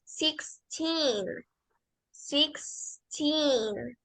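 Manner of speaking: put-on sixteen said slowly, teen held; long teen. Shown as a demonstration. Teacher models sixteen slowly for the students. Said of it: "ya sabemos que se pronuncia teen".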